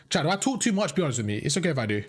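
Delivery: ordinary talk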